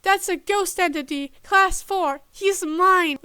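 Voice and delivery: loud roar with a cracking voice. A narrator's reading. Tim claiming Danny from the agent, roaring. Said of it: "Tim roared, voice cracking".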